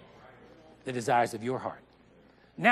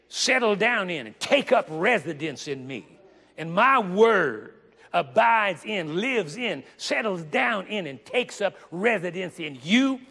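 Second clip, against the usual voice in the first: louder, building paraphrase that drops away at the end; rising preaching; 3 semitones higher, 4 dB louder than usual. Acted. Preacher to congregation, building paraphrase then hushed close. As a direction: build the verse up then let it fall.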